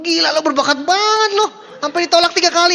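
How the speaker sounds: high, loud, sarcastic praise; hammed-up admiration for a failure; mock praise. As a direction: friend mockingly praising a failed singer. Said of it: "Udah pasti bilang".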